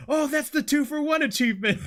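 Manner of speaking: high, loud excited fan; gleeful fan spotting the joke. Retold or imitated. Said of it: "that they would be like".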